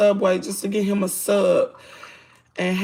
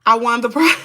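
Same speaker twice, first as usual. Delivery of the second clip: laughing; 7 dB louder than usual. Real, not acted. Jokes about winning, laughs.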